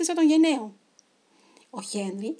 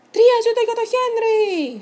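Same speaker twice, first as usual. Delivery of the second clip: high, very loud hurrah; shouted cheer; 11 semitones higher, 11 dB louder than usual. A narrator's reading. Freed crew toasting Henry the hero, shouting. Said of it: "φώναξαν".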